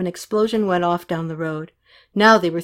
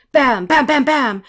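loud, high punched bangs; voiced gun shots; imitated gunfire; 6 semitones higher, 6 dB louder, slower than usual. A narrator's reading. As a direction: fire the bams in a burst. Imitates machine guns.

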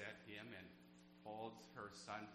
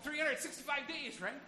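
much higher and louder, calling it out; louder answer; 13 semitones higher, 11 dB louder than usual. Shown as a demonstration. Pastor urging the congregation to answer louder, loud call. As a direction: shout the answer so the back rows join in. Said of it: "You can say a little bit louder than that".

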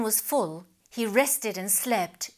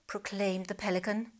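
ordinary delivery, nothing marked

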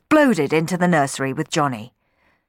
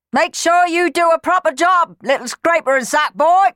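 much higher, loud yelled jeer; 13 semitones higher, 7 dB louder than usual. Acted. Sweep yelling taunts up the chimney, high loud jeer. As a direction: yell the taunt up the flue. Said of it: "yelled Clem".

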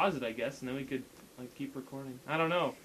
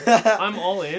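laughing; 4 semitones higher, 12 dB louder, slower than usual. Real, not acted. Mocking a posted poem.

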